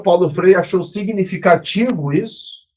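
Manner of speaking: usual speaking voice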